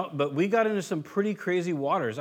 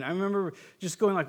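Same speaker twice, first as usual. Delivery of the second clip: ordinary talk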